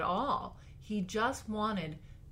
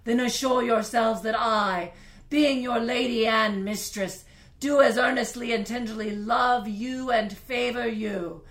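big period-drama voice; 3 semitones higher, 11 dB louder than usual. Pretend. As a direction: declaim like a queen rallying her subjects. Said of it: "I'm gonna try to make a big voice like they do in period dramas".

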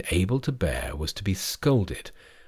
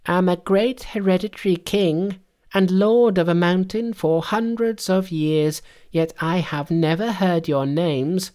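high-pitched, self-important monkey king; proud, high Monkey voice; boastful Monkey; 10 semitones higher than usual. A narrator's reading.

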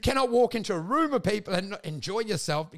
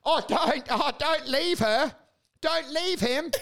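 mocking pleading advisers; 7 semitones higher, slower than usual.

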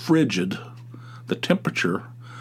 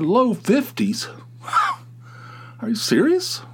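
mock disbelief; 11 semitones higher than usual. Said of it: "I said".

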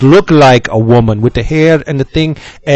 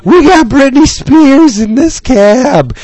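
put-on drunk yell; 11 semitones higher, slower than usual. Drunk girl shouting to the cabbie, slow mock yell. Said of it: "she screams".

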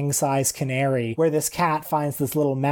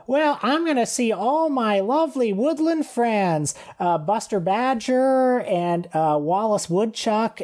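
high, sweet cartoon critter; cute squirrel voice; 7 semitones higher than usual. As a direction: chirp it like a cheerful woodland squirrel. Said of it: "lovable, uh, squirrel hopping through the forest".

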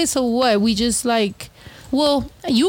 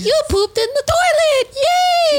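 put-on much higher, louder praise for a two-year-old; high baby-talk cheer, louder; cheering a toddler; 3 dB louder, slower than usual. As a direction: cheer like a parent at potty training. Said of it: "It's like".

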